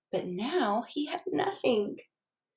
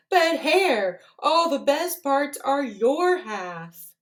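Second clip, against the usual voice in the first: slow, high whiny bear complaint; complain, hurt and slow; upset bear; 5 semitones higher, 4 dB louder, slower than usual. Acted. Bear upset at his pile.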